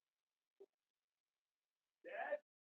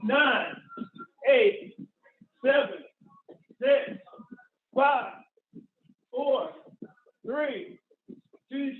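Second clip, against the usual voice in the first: barking gym coach; 3 semitones higher, slower than usual. Real, not acted. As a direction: drive them through the last seconds. Coach shouting encouragement, countdown.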